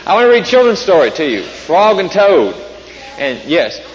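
ordinary delivery

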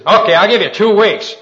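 mock-gruff challenge, loudly thrown out; imitated loud challenge. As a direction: act out a loud dare to a friend. Acted challenge from the retold buddy.

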